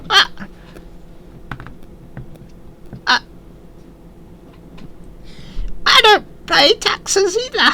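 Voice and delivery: very high, slow squeaky goblin. Acted. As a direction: draw it out in a tiny goblin squeak. Goblin replies to the party.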